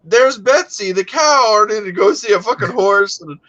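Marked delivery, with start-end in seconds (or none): 0.0-1.6 high, eager introduction of a pet cow; proud introduction. Retold or imitated. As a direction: present the cow proudly, pitched up. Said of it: "he goes like".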